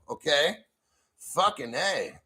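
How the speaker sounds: ordinary talk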